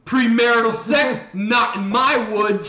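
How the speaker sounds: hammed-up killer, loud and slow; slasher villain voice. Jason defending his woods from campers, mock menacing bellow. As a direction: boom it like a movie slasher guarding his woods.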